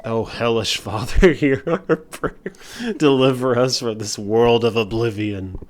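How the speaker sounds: loud fervent villain prayer; dark prayer. Acted. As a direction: pray to the dark father.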